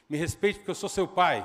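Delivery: father's loud rebuke, pitch barely up; acted loud fatherly demand; loud stern command. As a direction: bellow the command as the angry father. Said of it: "vou falar alto".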